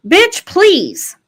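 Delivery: high, loud sassy dismissal; a high mocking bitch please; sassy retort. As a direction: toss it off with attitude. Sassy retort in retold scene.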